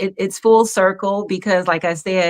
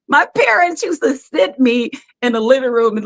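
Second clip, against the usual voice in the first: usual speaking voice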